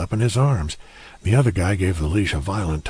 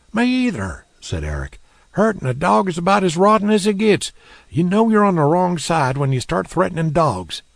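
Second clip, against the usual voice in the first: a much higher, louder indignant man's voice; Eric's voice, higher; 11 semitones higher, 5 dB louder than usual. Acted. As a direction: scold the men for picking on a dog. Eric voiced as character.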